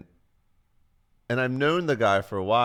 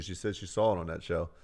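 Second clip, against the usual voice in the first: ordinary delivery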